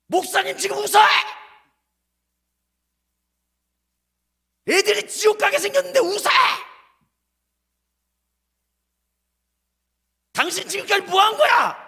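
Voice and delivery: high, loud outraged yelling; shouted rebuke at a pastor; furious shout. Retold or imitated. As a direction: blast the pastor for laughing. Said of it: "너무나 내가 화가 져-, 나가지고".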